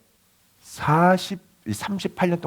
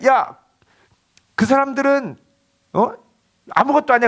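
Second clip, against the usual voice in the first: confident call; 9 semitones higher, 4 dB louder than usual. Retold or imitated. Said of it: "그러면서".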